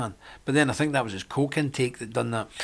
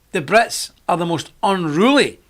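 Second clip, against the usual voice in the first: ordinary talk, nothing marked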